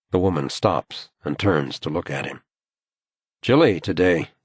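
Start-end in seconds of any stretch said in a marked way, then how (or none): none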